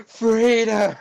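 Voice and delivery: high, soaring patriotic cry; heroic cry. Retold or imitated. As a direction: call it out like a hero. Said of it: "Just like".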